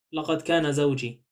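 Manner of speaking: usual speaking voice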